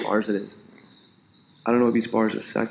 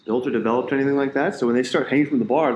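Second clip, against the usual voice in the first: ordinary delivery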